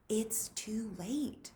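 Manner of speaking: slow soft whisper; whispered to himself. Acted. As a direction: hush it, trying to convince yourself. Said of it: "he told himself".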